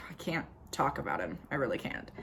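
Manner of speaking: low, very soft reluctance; reluctant, withdrawn. Acted. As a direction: drop to a low near-murmur, unable to go on. Breaks off talking about Jack.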